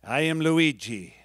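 solemn old grandfather passing on his name, lightly voiced; lightly voiced stern Italian grandpa, slow and weighty; grandpa's serious voice. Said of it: "in a very serious voice he would say".

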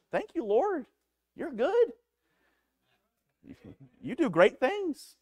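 high, slow, heartfelt thanks to God; high-pitched praise, slowed down; fervent prayer. Shown as a demonstration. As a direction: lift the words up like a grateful prayer. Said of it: "Just pray".

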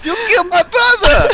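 very high, loud character shriek; deadly cyber voice. Pretend. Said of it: "Do deadly cyber voice".

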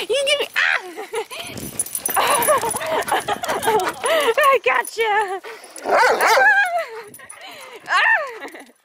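high, giggly play with the dog; laughing. Real, not acted. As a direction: laugh while wrestling the puppy. Playing with the dogs.

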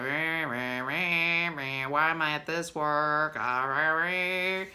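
low mock grumble; grumbling worker. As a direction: imitate a grouchy worker moaning. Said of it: "and they're like".

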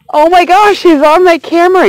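real excited outburst. Real, not acted. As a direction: gush at the viewers in delight. Grasshopper lands on her camera.

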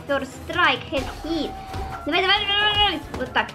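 ordinary delivery

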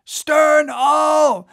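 high, loud, drawn-out command; urgent whaleboat shout; shouted order. A narrator's reading. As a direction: bellow to the oarsmen to back off.

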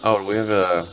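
normal talking voice

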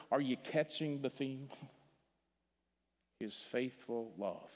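ordinary talk, nothing marked

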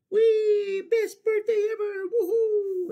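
very high, loud whoop of joy; overjoyed turtle. Acted. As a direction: shriek with birthday glee. Turtle swooshing happily.

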